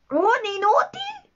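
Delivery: very high, rising "¿Un inútil?"; slow, incredulous high-pitched "¿Un inútil?"; confused echo. A narrator's reading. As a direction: repeat "¿Un inútil?" as a bewildered question. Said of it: "repitió Garfio, confuso".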